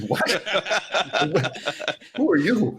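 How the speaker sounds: puzzled what-is-going-on reaction; baffled surprise. Retold or imitated. Retelling his shock when the enemy player is friendly, laughing disbelief. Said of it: "And I'm like".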